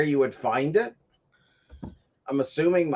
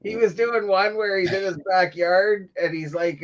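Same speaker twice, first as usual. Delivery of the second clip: ordinary talk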